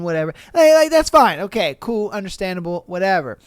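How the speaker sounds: usual speaking voice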